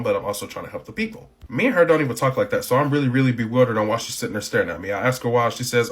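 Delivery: ordinary talk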